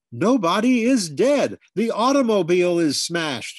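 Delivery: loud, high exasperated shout. A narrator's reading. Father shouting at the confused grandfather, loud and high. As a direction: yell the news at him in frustration. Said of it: "shouted my father".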